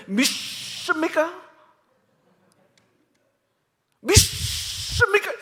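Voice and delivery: drawn-out, rising then booming "믿습니까?"; slow, high revival-preacher call; old-style revival preacher. Retold or imitated. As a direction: mimic a fiery preacher working the crowd. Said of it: "한때 설교자들이 다 이렇게 설교했어요".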